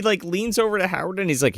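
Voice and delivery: ordinary talk, nothing marked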